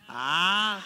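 real laughter fading away; hearty laugh. Real, not acted. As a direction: laugh at your own joke. Laughs at his own joke.

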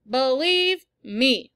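slow loud insistence to brother; yelled. A narrator's reading. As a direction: press it hard. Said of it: "I yelled".

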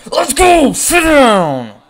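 high, loud yell; real hype yell; celebratory shout. Real, not acted. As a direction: bark the taunt at the batter. Strikeout celebration.

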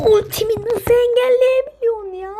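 high, loud stretched complaint; loud crying-out disbelief; outraged cry. Real, not acted. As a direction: wail at the unfair block. Ultimate blocked again.

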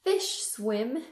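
gentle sing-song picture-book read; reading to a toddler. Acted. Storytime book read to kids.

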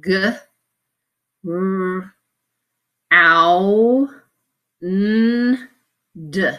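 sounding out G, R, ow, n, d; each sound said apart with pauses; letter sounds. Shown as a demonstration. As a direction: spell it sound by sound. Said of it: "So we need to have".

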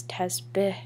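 Tazbeh said slowly, short soft final "beh"; mother's careful pronunciation. A narrator's reading. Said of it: "she says again like I've forgotten".

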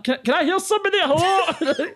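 high-pitched mock female customer, laughing; baffled high voice calling out; puzzled customer. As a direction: imitate her searching call, very high. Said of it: "And she's like".

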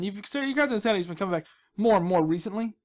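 usual speaking voice

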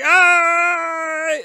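sharp high pained cry; loud high frustrated yelp. Real, not acted. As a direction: yelp as if hit.

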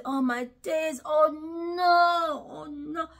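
hammed-up sobbing oh no; mock panic. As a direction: fake a tearful panic. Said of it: "I didn't look and say".

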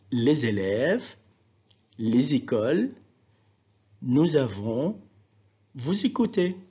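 linking Z in "les élèves, les écoles, nous avons, vous écoutez"; liaison. Shown as a demonstration. Teacher asking learners to listen, Z linked in "les élèves, les écoles, nous avons, vous écoutez". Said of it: "Voilà la liaison".

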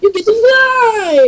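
high, slow, gleeful shout; excited shout. Real, not acted. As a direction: yell it out with glee. Friend launches him into air.

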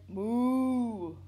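loud drawn-out imitated moo; cow moo. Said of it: "Cow said".

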